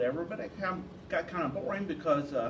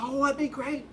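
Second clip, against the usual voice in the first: high, imitated enthusiasm; mildly hammed-up delight; eager agreement; 7 semitones higher than usual. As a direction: play a friend keen on the plan. Acted eager reply to the plan.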